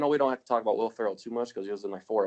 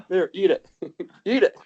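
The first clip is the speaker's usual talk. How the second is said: loud laughing commands; 8 semitones higher, 5 dB louder than usual. Retold or imitated. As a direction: laugh while ordering. Acting out the broccoli scene.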